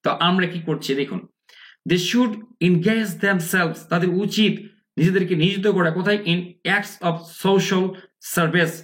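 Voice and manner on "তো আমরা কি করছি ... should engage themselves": ordinary talk, nothing marked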